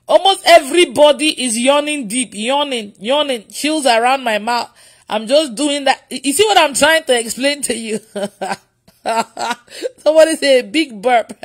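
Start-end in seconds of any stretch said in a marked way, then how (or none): none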